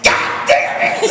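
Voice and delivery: high, drawn-out frustrated yell; comic cry of frustration; exasperated outburst. Acted. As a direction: yell it out in exasperation at the gas pump. Exasperated outburst at gas pump.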